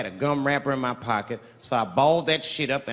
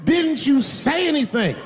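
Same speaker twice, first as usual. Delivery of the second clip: high, slow outraged cry; betrayed; 12 semitones higher, slower than usual. Retold or imitated. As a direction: wail it like you were tricked. Confronting his dance partner.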